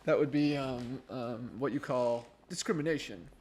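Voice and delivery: Sean from Psych. Retold or imitated. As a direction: imitate a TV character announcing it with emphatic pauses. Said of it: "when Sean was like".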